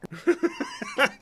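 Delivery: real high-pitched giggle; laugh. Real, not acted. Streamer reacting to a misspoken word in the clip, laughing. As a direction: burst out in helpless, squeaky laughter. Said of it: "I'm laughing at".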